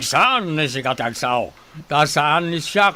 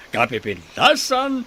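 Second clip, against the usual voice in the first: ordinary talk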